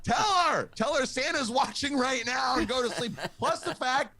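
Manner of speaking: very high, frantic comic pleading; panicked inner voice. Acted. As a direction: plead in a strained squeal like a desperate inner voice. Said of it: "I had that one voice going".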